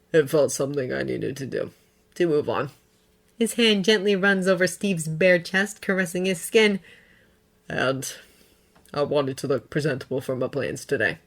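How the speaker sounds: soft and low. A narrator's reading. Bucky's quiet gentle confession.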